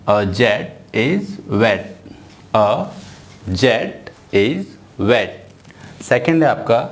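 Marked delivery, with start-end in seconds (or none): 0.1-2.9 "A jet is wet" word by word, very slowly; very slow reading. Shown as a demonstration. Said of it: "मैं किस तरह से read कर रहा हूँ". 3.5-5.3 "A jet is wet" word by word, very slowly; very slow reading. Shown as a demonstration. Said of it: "मैं किस तरह से रीड कर रहा हूँ".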